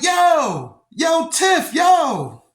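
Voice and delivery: high, loud calling out across the room; retold shout. Brother calling out to his sister at the police station, loud shout. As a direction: yell her name to get her attention.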